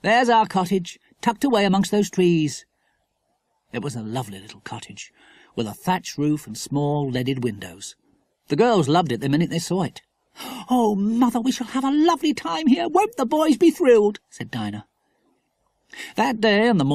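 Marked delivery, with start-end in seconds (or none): 0.0-2.6 higher, lively mother's voice; mildly excited, lifted pitch; pointing it out brightly. A narrator's reading. 10.7-14.2 put-on high-pitched, gushing child; excited little girl voice, pitched well up; delighted girl. A narrator's reading. Girl delighted by holiday cottage.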